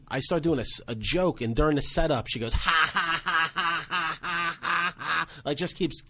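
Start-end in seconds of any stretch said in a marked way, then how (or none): 2.5-5.3 imitating a heckler's put-on cackle; higher mock laugh, ha ha over and over; mock fake laugh. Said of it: "That's how she laughs".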